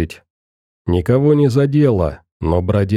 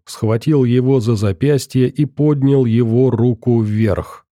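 ordinary delivery, nothing marked

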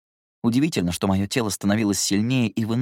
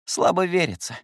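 hoarse; 9 semitones higher than usual. Acted. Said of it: "хрипло".

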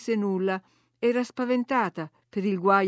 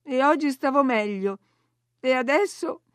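higher-pitched, aggrieved complaint; plaintive moan; 5 semitones higher, 3 dB louder than usual.

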